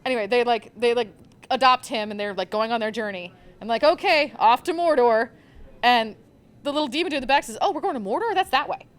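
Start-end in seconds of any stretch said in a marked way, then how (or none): 3.8-5.3 louder, higher, cheery mock send-off; a hammed-up rally to march off; eager setting off. The heroes cheerfully announcing their journey, mock excited. 7.6-8.9 lightly voiced small demon, higher and helpful; little demon dude. The little demon in the back correcting the heroes, higher chirpy voice.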